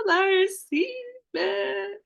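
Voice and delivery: high little voice for the ego; ego's voice. Pretend. Ego voiced receiving love.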